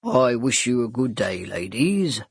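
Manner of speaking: see the ladies out politely; polite butler. Acted. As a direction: Wilson the butler bidding the ladies good day. Butler farewell.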